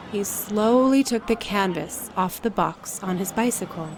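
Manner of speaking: plain reading voice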